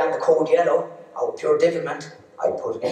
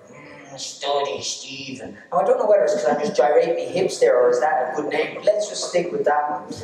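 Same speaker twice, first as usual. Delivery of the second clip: ordinary delivery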